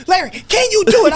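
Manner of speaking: high, loud demanding question; demanding shout. Retold or imitated. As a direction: put him on the spot, shouting. Retold argument, Amir demands.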